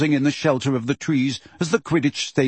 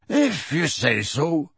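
shrugging; 3 semitones higher, slower than usual. Acted. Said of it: "said Hagrid, shrugging".

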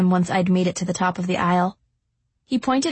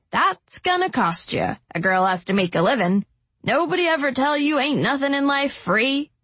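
chiding; 6 semitones higher than usual. A narrator's reading. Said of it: "she said in a chiding tone".

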